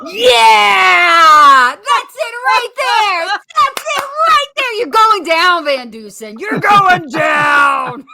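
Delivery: high, loud, whooping trash talk; hyped fighter shout. Pretend. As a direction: pump up the crowd like a boxer. Fighter hyped by ring introduction.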